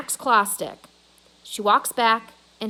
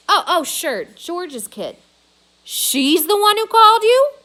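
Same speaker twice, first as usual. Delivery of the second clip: surprised; 9 semitones higher, 11 dB louder than usual. A narrator's reading. Mac realizing on phone.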